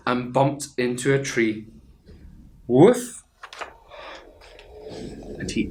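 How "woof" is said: loud high woof; dog bark. Acted. Dog skeleton crashing into a tree, loud yelping woof.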